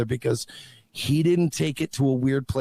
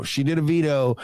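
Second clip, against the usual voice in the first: normal talking voice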